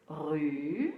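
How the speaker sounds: "Ru-bi" drawn out, long gap between syllables. Shown as a demonstration. Teacher sounding out the word with pupils, syllable by syllable. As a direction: stretch "Ru" upward, pause, then "bi". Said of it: "Allons-y avec les caplats".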